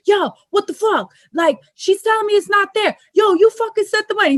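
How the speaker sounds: high-pitched mock rant in the background; imitated flipping out. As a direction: act out a man going off at someone. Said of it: "flipping on somebody".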